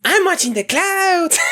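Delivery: put-on high girlish Mexican accent; badly faked high Mexican accent. Shown as a demonstration. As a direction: pitch it way up for a Mexican girl. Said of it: "how to make a girl voice and".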